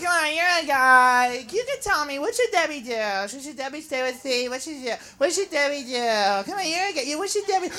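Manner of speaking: soft, wheedling nagging; girlfriend's friend nagging the guy, softly wheedling; whiny pleading girlfriend. Retold or imitated. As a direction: pester him softly for advice. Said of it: "they're always like".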